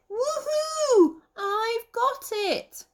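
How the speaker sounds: high squeaky victory shout; triumphant shout. Acted. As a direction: yell it in triumph as the squirrel. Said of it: "as Nutkin shouted".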